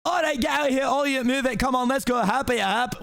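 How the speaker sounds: high, insistent ordering; acted herding shout. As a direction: drive a crowd out with urgent calls. Shrek herding intruders away.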